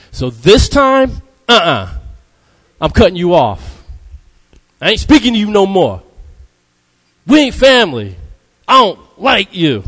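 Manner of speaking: high, loud sassy brush-off; indignant cut-off. Retold or imitated. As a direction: done with them, sassy and loud. Mock unforgiving person cutting someone off.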